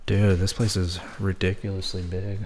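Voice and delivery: usual speaking voice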